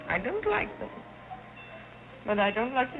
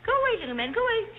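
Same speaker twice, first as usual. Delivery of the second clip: shooing away; 10 semitones higher, 5 dB louder, faster than usual. Acted. Shooing imaginary pixies away.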